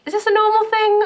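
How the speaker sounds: high, loud squeaky question; incredulous question. Real, not acted. Asking viewers, in loud high disbelief.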